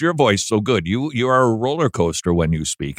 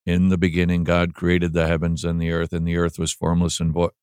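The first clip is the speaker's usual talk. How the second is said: low, droning monotone with no life; a lifeless scripture reading in a low drone; dull monotone reading; 6 semitones lower, 3 dB quieter than usual. Shown as a demonstration. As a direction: read it flat and bored, like a chore. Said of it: "It shouldn't just be read like".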